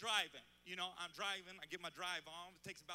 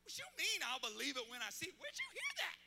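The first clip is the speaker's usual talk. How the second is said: indignant disbelief; 8 semitones higher, 5 dB louder than usual. Retold or imitated. Dad outraged at son's remark.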